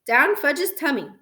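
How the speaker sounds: plain reading voice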